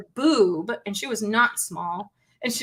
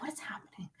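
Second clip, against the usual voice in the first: breathy whispered disbelief; 3 semitones higher, 21 dB quieter, faster than usual. Retold or imitated. Gun class chaos, whispering to herself in disbelief. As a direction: hush it, barely voiced.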